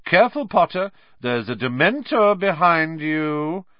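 taunting Malfoy. A narrator's reading. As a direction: spook Potter with a taunt.

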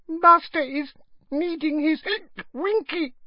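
very high, hiccuping whimper; squeaky drunken whine with hiccups; whimpering elf. A narrator's reading. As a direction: whimper it like a tipsy little elf. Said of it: "whimpered the elf".

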